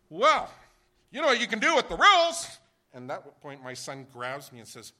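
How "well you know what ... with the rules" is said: much higher, loud furious yell; hungry traveller exploding at the clerk; angry outburst. Retold or imitated. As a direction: lose your temper completely. Retold blowup at airline clerk.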